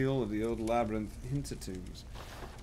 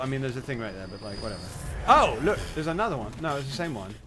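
normal talking voice

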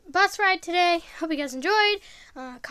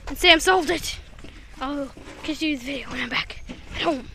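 soft, fast whisper-like aside; hushed aside; 7 semitones lower, 12 dB quieter than usual. Real, not acted. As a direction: murmur it quickly so others won't hear.

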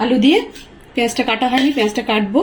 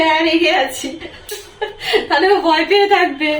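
higher voice breaking into a laugh; amused, laughing; 5 semitones higher than usual. Real, not acted. Mother joking about telling her son she's angry, laughing. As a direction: say it through a rising chuckle.

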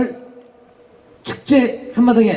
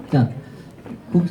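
normal talking voice

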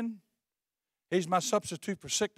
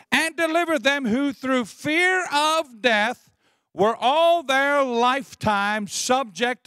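loud, drawn-out proclamation with raised pitch; slow, forceful scripture declaiming; preached scripture; 6 semitones higher, 6 dB louder, slower than usual. Acted. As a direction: stretch each key word and ring it out to the congregation.

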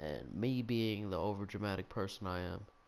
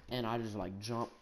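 usual speaking voice

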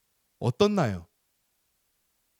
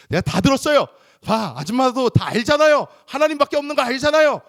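high, loud, fast gloating; child gloating to the mother that he heard; triumphant gloat; 12 semitones higher, 10 dB louder than usual. Retold or imitated. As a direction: rub it in with a shout.

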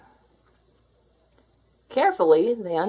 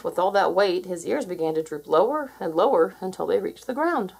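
usual speaking voice

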